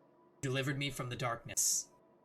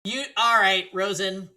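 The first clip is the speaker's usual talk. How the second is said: louder, higher shouts of a name; calling out; 5 semitones higher, 9 dB louder, slower than usual. Real, not acted. Player calling to Rosen, loud repeated calls. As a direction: call repeatedly to get attention.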